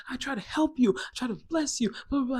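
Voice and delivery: high-pitched mock yell; imitated yelling dad. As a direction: act out dad yelling in self-defence. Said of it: "My dad's yelling at me".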